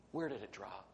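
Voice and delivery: soft, unhurried low question; calm, quiet prophet. Acted. Prophet calmly asking the panicked student, softly. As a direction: stay quiet and easy, no worry at all. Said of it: "prophet of God goes".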